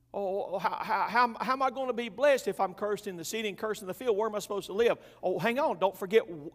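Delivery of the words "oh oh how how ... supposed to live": mock panic. Mock panicked reaction to curse.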